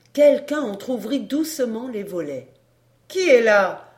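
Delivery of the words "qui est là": woman calling out, louder; woman's voice. A narrator's reading. Woman at the shutters challenging strangers, calling out. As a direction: call a challenge into the dark. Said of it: "demanda une voix de femme".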